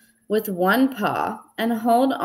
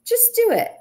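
impatient push; 14 semitones higher than usual. A narrator's reading. Franklin pushing Badger.

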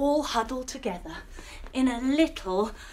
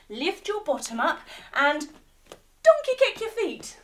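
usual speaking voice

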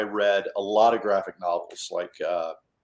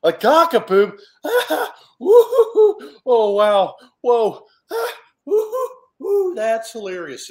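high squealing laughter, much louder; cracking up; 13 semitones higher, 9 dB louder, slower than usual. Acted. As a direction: laugh through the words like kids in hysterics. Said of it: "Louie and Bear are cracking up".

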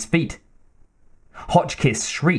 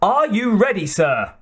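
high-pitched call at ordinary volume; 7 semitones higher than usual. A narrator's reading. Bassett asking his duelling opponent if he's ready, calling out. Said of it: "called Bassett".

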